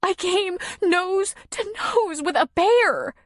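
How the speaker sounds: high, slow, tearful telling; shaking voice. A narrator's reading. Nora recounts meeting the bear, voice shaking. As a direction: quaver through it, close to crying. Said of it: "her voice shaking".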